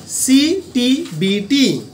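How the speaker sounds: very slow, spaced-out CTBT; drawn-out CTBT for note-takers; slow dictation. Shown as a demonstration. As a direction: say CTBT letter by letter, stretching each one. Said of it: "আমি আস্তে আস্তে বলছি".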